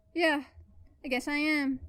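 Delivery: ordinary delivery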